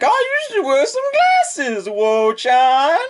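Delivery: very high, slow teasing coo. Acted. Teasing Wo class about glasses, very high coo. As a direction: tease her sweetly. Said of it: "being like".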